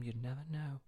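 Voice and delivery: hushed, breathy gossip whisper. Retold or imitated. Actor whispering gossip to fans at a meet and greet. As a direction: lean in and whisper the secret.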